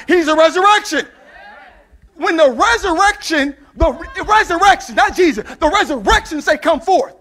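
high, shouted sermon climax; loud, driving preacher's refrain; full-force preaching. Real, not acted. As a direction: shout it over the congregation.

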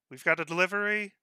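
briskly announcing a delivery; calling out a delivery. Acted. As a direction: call it out brightly to get the door opened. Guillermo announcing at the mayor's door.

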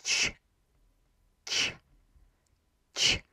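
"ch" very soft, tongue near the palate; a soft, hushed "ch"; soft Polish ć. Shown as a demonstration. Teacher showing the accented c sound. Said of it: "it's gonna be".

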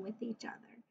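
soft secret whisper; whispered aside. Real, not acted. Teacher trailing into whisper.

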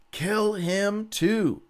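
high, slow shouted order; shouted command. Acted. Jehu yelling after the fleeing king, high and slow. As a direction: call it out in hot pursuit.

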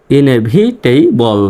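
Inevitable stretched out very slowly, syllable by syllable; slowly enunciated inevitable; slow demo. Shown as a demonstration. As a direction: draw out inevitable slowly for the class. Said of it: "এটা আমরা এভাবে উচ্চারণ করতে পারি".

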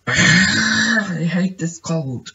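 high, slow whiny moan; whiny wail. Real, not acted. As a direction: drag it out in a high whine.

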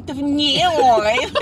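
NOW exaggerated into a long "nyow"; mock pronunciation. Denying they talk that way, exaggerated mimicry. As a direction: overdo the catty vowel in NOW. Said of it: "I don't say".

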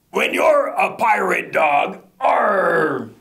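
slow, hammed-up pirate chant; pirate refrain.

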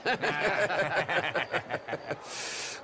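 a long gleeful cackle; gleeful laugh. Acted. Host laughing off a guest's remark, gleeful. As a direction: laugh it off with relish.

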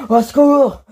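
loud, high panicked cry; scream for help. Acted. Captive woman calling for rescue, loud panicked scream. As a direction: yell for help at full force.

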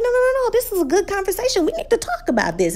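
high, quick mock protest; retold insistent objection. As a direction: butt in eagerly, pitch up, then settle. Brother insists in acted argument.

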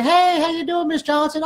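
high-pitched mock phone greeting; phone rep voice. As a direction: put on a high, chirpy rep voice. Voicing rep greeting his client.

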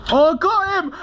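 high triumphant cry; excited. Real, not acted. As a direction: shout it out in triumph. Scoring a kill in game.

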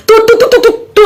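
high, rapid repeated syllables; mocking animal call. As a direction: taunt with a high-pitched mock summons. Mocks rival as if calling a dog. (ব্যঙ্গ করে তু-তু ডাক)

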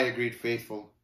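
usual speaking voice

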